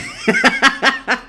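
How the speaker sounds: high-pitched burst of laughter; sudden high giggle. Real, not acted. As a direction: cackle at the joke. Laughs at own joke.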